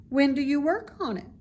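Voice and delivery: put-on pleading young pig; begging. A narrator's reading. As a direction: beg her to tell. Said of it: "begged Wilbur".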